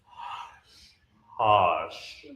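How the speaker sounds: "Hush" whispered, sounds melting together; blended breathy hush. Shown as a demonstration. Director showing the blended whispered hush. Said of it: "try to blend them".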